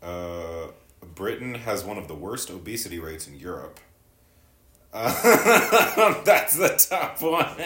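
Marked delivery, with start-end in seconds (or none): 4.9-7.5 bursting into high loud laughter mid-sentence; laughing. Real, not acted. Reads comment, bursts laughing.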